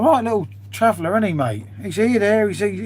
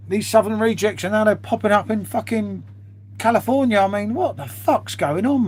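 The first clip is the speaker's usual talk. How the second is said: ordinary talk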